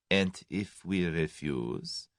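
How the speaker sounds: slow, soft and level; quietly weighing the threat. A narrator's reading. The German coldly answering Tommy, quiet and slow. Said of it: "said the German quietly".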